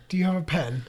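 normal talking voice

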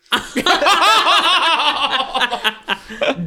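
real laughing fit while reading; burst of laughter. Real, not acted. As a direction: crack up at the entry. Reading the slang book.

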